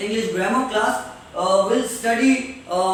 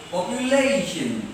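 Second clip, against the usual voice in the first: POPULATION with a stretched "LAY"; stress before -tion; 3 semitones lower than usual. Shown as a demonstration. Teacher showing where accent falls, stretched "LAY". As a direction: lean on "la" in POPULATION. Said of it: "जोर से पढ़ना है".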